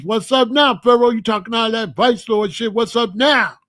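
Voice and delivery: loud, high jeering call across the cell; mocking taunt. Cellmates jeering at the lone vice lord, loud mocking taunt. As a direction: rub it in, taunting him.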